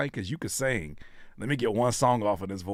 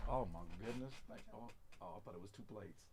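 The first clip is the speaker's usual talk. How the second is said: quiet thanks; 3 semitones lower, 23 dB quieter, much faster than usual. Real, not acted. Thanks partner for food off mic.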